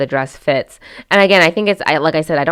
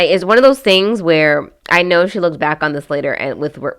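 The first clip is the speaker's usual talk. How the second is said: normal talking voice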